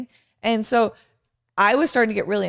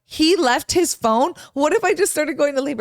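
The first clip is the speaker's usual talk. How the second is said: higher-pitched incredulous questions; retold disbelief; 6 semitones higher than usual. Wife retells finding husband's phone left behind, incredulous. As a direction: relive the alarmed discovery.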